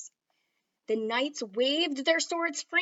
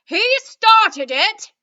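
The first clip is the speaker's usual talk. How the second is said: much higher, louder, slow blaming shout; accusing cry; 9 semitones higher, 16 dB louder, slower than usual. Acted. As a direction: point the finger and yell. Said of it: "he cried".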